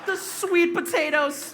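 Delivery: high, slow, loud mocking through laughter; mocking repeat. Comic mocks dad's band name, high slow laughing. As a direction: savour the dumb name, laughing. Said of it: "He meant the Smashing Pumpkins".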